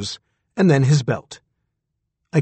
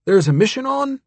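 higher, eager question; lightly voiced old man perking up; old astronaut hearing of a mission, surprised; 9 semitones higher than usual.

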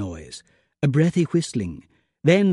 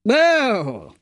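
sharp, higher startled "Oh!"; surprised; 6 semitones higher than usual. A narrator's reading. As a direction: cut him off with a sudden gasp. Sudden exclamation.

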